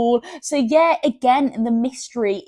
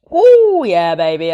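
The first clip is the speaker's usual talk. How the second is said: excited; 9 dB louder, slower than usual. Real, not acted. After a sip of coffee.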